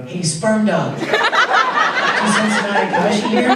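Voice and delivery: slow, playful, laughing line read; cheeky alternate take. Shown as a demonstration. Prank take of a line to the spirit dog, cheeky. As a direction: slip the joke name in with a grin. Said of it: "I thought it would be fun to go".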